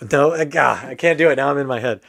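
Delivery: ordinary talk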